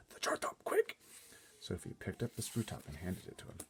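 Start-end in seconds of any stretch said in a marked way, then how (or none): 0.1-1.0 hushed, hurried whisper; urgent whisper. A narrator's reading. Giant asks Sophie for the lid, urgent whisper. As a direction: whisper it fast, almost squeaking at the end. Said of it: "he whispered".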